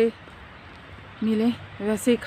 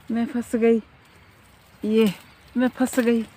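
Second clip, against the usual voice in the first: louder, higher startled cries; caught in thorns; 4 semitones higher, 7 dB louder than usual. Real, not acted. Vlogger snagged by thorns in the park, startled and loud. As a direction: yelp as thorns snag you.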